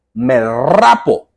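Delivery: RAPO drawn out slowly and loud; emphatic mock vow. Real, not acted. Betting viewers the exercise works, emphatic mock vow on "rapo". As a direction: lean hard and long on "rapo".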